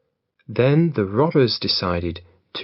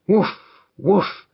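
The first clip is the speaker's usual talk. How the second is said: higher put-on bark; dog barking; 8 semitones higher than usual. The dog barks to frighten the robbers, higher woofing bark. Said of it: "and the dog barked".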